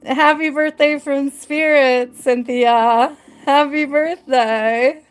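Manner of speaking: thrilled, drawn-out birthday wishes; delighted birthday surprise. Real, not acted. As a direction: share an astonishing happy coincidence.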